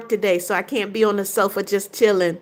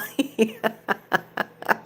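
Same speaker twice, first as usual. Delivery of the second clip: laugh; 6 dB quieter than usual. Real, not acted. Reading a funny viewer comment.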